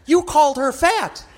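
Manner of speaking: imitated wife's scandalised outcry; shocked wife. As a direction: accuse in mock horror. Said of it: "and she went".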